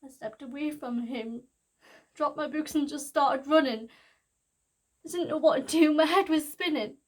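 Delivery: loud, distraught cry that keeps building; panicked outburst. Acted. Girl reliving running from her friend's brother, rising panicked shout. Relives fleeing after the news.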